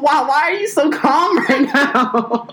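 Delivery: high, laughing slow disbelief. Retold or imitated. Son on the phone laughing at his mom's calm. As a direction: ask it half laughing. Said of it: "I was like".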